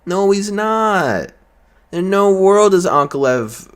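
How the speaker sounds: high, slow indignant outburst; outraged. Real, not acted. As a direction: drag out the denial in disbelief. Ranting against fans.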